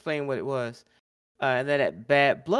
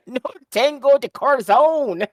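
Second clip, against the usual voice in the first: put-on much higher, louder hammy Spanish accent; bad Spanish accent; 10 semitones higher, 10 dB louder than usual. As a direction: belt it in an awful Spanish accent. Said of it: "in the worst Spanish accent ever".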